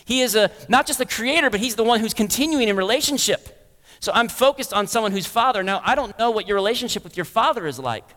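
ordinary delivery

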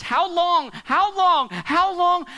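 loud, high pleading how long; anguished cry. Acted. Preacher voicing David crying out to God, anguished.

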